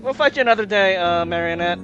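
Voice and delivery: shouting a challenge; loud defiant call. Acted. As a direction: call it out boldly. Calling out a defiant threat.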